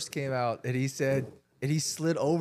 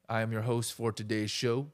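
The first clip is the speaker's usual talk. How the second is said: ordinary delivery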